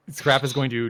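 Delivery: usual speaking voice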